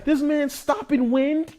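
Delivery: very high, loud astonished cries through laughter; laughing disbelief. Retold or imitated. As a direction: shriek in amazement, cracking up. Astonished reaction to the miracle.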